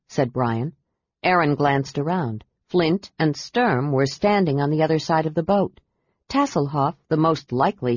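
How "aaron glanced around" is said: usual speaking voice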